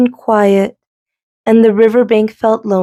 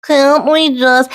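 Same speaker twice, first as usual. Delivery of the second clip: moaned; 6 semitones higher than usual. Acted. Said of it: "Goose moaned".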